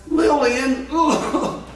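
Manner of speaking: high scornful groan at the name; disgusted. Real, not acted. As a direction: recoil at the name.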